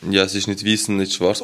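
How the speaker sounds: usual speaking voice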